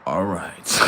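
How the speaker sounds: normal talking voice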